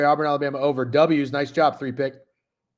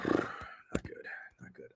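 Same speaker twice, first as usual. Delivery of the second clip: sighed whisper; 15 dB quieter, much slower than usual. Real, not acted. Streamer admitting his Missouri pick lost, sighed whisper. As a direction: whisper it on a sigh, defeated.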